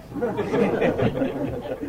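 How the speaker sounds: breathy chuckle without voicing; real laugh. Real, not acted. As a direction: chuckle at the retold scolding. Laughs after quoting the scolding.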